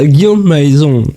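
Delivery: imitation lente d'un accent anglais, syllabes étirées; « Guillaume Maison » prononcé lentement à l'anglaise, en étirant les syllabes; accent british. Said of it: "avec son accent british". (imitation lente de l'accent anglais)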